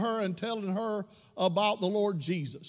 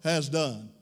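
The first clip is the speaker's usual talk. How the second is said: louder, ringing sermon call to go and tell; preaching at full force; 5 dB louder than usual. Acted. Pastor urging his church to share the bread, full-force preaching. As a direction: preach it out over the organ. Preacher building to climax, music.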